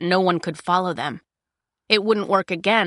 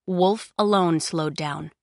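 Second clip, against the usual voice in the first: usual speaking voice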